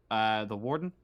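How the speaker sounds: usual speaking voice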